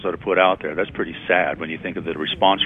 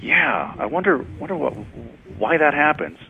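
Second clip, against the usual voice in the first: higher, puzzled radio ad character; a different, higher voice answering the first in a radio ad; second ad voice; 7 semitones higher than usual. Retold or imitated. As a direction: play the confused customer in a radio spot, voice pitched up. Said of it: "the second voice, very dissimilar from the first one".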